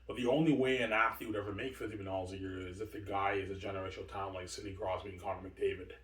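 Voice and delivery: ordinary talk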